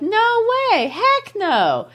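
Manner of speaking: high-pitched, emphatic mock refusal. Teacher paraphrasing the poet's "Nay", emphatic high refusal. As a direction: refuse strongly, pitch leaping up. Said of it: "He feels strongly".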